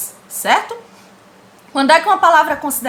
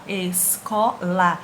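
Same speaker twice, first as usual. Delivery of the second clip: ESCOLA slow and lower, split es-co-la; syllable by syllable; 5 semitones lower, much slower than usual. Shown as a demonstration. Teacher splits the word for the class, slow syllable-by-syllable ESCOLA. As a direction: break ESCOLA into three separate, measured syllables.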